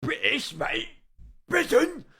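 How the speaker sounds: high, loud caricature British; mock British accent. Streamer mimicking British teens he could easily tell apart, mock British accent. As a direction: yelp it in exaggerated British. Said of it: "if they were British".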